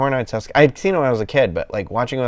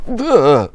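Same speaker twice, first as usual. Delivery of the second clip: disgusted groan; 7 semitones higher, 4 dB louder than usual. Retold or imitated. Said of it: "that made me like".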